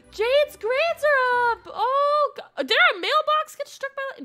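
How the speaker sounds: high, very loud outburst; excited shriek. Real, not acted. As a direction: shriek it in amazement after a gasp. Gasps at news, then lightning strike.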